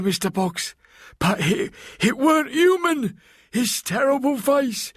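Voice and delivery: a shaken maid pleading her tale; frightened maid Delilah. Acted. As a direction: Delilah telling Mister Box what happened, frightened. Delilah tells her story.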